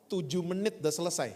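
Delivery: ordinary delivery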